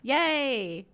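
high, loud fake yay; mock cheer. Faking excitement at a football party, mock cheer. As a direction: cheer along without a clue. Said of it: "and I'm like".

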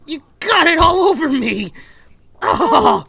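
high, loud grossed-out yell; loud, high disgusted cry. Acted. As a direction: shriek about getting sprayed. Bartender sprayed with drink.

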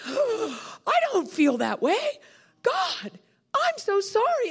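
high, acted tearful plea; bursting into tears. Preacher re-enacts repenting to God, bursting into tears. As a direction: wail the apology as if crying. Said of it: "I burst into tears".

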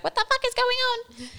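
put-on high, squeaky voice for a baffled baby snake; confused snake. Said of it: "It just kind of was like".

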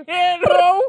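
laughing high through the word. Real, not acted. Mocking piano.

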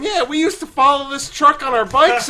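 high, loud mock nostalgic voice; imitating older people. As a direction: play them up as carefree. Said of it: "people older than me going".

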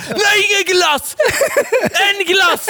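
very high-pitched mock tantrum shriek; screaming child. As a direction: imitate a kid shrieking a refusal. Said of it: "Bara skriker".

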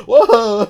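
big loud burst of high laughing; loud laughter. Real, not acted. Cracking up loudly at his own joke, real laughter.

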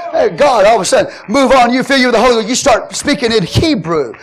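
loud, high, rising preacher's cry; fired-up Pentecost preaching; full-force preaching. Acted.

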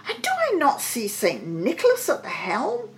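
high, wondering king; amazed cry. A narrator's reading. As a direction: marvel aloud in a high voice. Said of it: "he cried".

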